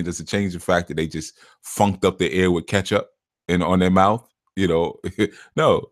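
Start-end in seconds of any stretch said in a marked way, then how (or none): none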